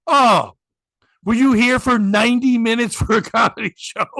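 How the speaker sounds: loud, emphatic mock interrogation; punchy, indignant questioning; retold sarcastic scolding. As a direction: act out an owner telling off customers. Retold husband scolding customers.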